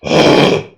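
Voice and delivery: loud, rough cough without voice; abnormal cough. Shown as a demonstration. Instructor showing students an unhealthy cough, abnormal cough. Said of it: "abnormal is".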